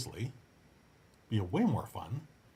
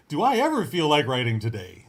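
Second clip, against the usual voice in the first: loud, higher burst of eagerness; emphatic enthusiasm; 4 semitones higher, 9 dB louder than usual. Acted. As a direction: belt it out like you can't wait to start. Contrasting enthusiastic answer to question.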